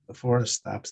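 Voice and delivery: ordinary talk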